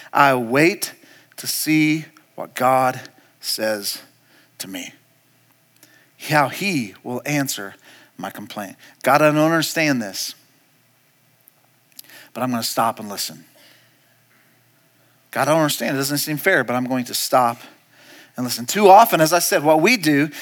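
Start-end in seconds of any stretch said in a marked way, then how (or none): 0.1-8.8 low, soft reading with long pauses; hushed scripture reading. Acted. Pastor reading Habakkuk's waiting on God, hushed and reverent. 9.0-18.7 low, soft, halting prayer; quiet prayer. Acted. Believer telling God he doesn't understand, hushed prayer.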